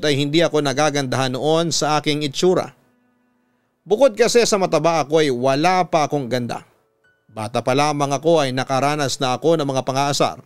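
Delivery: ordinary delivery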